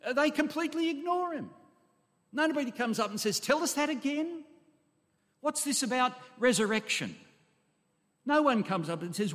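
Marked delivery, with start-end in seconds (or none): 3.4-7.1 curious questioning. Acted. Imagined disciples asking about resurrection.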